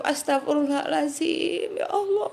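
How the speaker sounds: high, tearful plea for forgiveness; a weeping cry for forgiveness; crying prayer. Shown as a demonstration. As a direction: say it while weeping to God. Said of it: "sambil nangis".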